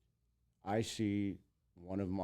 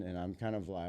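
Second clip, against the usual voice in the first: ordinary talk, nothing marked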